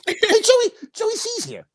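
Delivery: very high cartoon squeak; high, bright-eyed cartoon greeting; cartoony puppet voice. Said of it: "something more cartoony".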